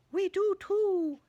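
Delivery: high, loud and slow owl voice. A narrator's reading. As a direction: let the owl answer, drawn out and high. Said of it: "said the owl".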